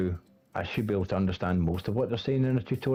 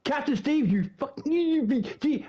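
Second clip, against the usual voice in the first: mock spluttering outrage. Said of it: "I thought".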